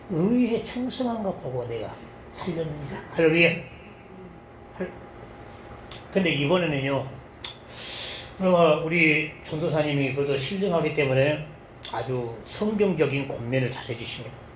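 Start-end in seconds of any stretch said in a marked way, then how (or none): none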